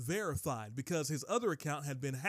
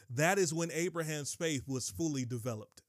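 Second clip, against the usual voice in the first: ordinary talk, nothing marked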